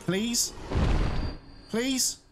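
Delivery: high, rising little "please?"; pleading. Real, not acted. Player begging an enemy for vials, pleading. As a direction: beg with a hopeful upward lilt.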